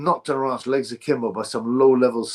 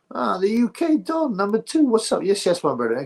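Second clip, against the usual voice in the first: excited greeting; 8 semitones higher than usual. Real, not acted. Welcoming a late viewer in the live chat, excitedly high.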